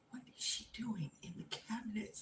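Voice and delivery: whispered bewilderment; hushed, disbelieving question to yourself; anxious whisper. Retold or imitated. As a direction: whisper it as a private panicked thought. Whispered thought while frozen at door.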